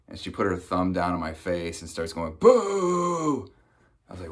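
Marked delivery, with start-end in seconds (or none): 2.4-3.5 long, high, loud boo; hammed-up drawn-out heckle; mock jeering boo. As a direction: jeer like a heckler in a put-on high voice. Acted woman jeers his college.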